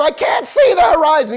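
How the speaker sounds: loud, high panicked yell; student pilot shouting back at instructor, panicked. Retold or imitated.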